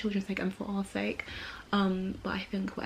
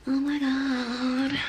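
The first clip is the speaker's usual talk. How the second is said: surprised gasp; 7 semitones higher, 5 dB louder, much slower than usual. Real, not acted. Reacting to belly.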